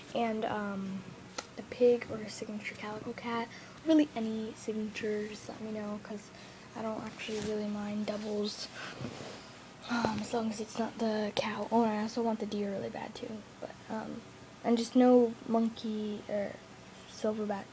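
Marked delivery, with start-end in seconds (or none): 1.6-3.5 whispering. Real, not acted. Whispering to camera. 6.8-13.4 whispering. Real, not acted. Whispering to camera.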